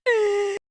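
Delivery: high, loud burst of laughter. Real, not acted. Reaction to gifted subs.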